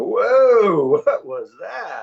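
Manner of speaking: loud, high drawn-out whoa, then slow disbelief; mock appalled reaction. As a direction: recoil loudly at an awful accent. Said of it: "just go".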